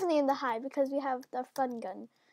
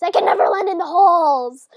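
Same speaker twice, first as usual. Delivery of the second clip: complaint bursting into a loud yell; exasperated, much louder toward the end; frustrated complaint; 4 semitones higher, 14 dB louder than usual. Real, not acted. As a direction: blow up as the grievance builds. Complaining about hard map.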